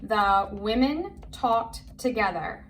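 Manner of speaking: slow, word by word; careful, spaced-out read-along voice; model reading. Shown as a demonstration. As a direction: read it out slowly for a learner to follow. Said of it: "Say it with me".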